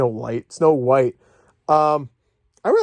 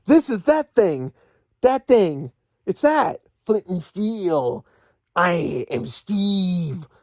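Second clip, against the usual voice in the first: slow, drawn-out dopey movie line; slow mocking Steve impression; 4 semitones higher, much slower than usual. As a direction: ham up each item name like a dumb hero.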